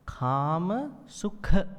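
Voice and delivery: slow "kama sukha" with a breathy kh; aspirated kh. Shown as a demonstration. Teacher models breathy sukha for students. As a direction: push air on the kh of "sukha". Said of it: "So here".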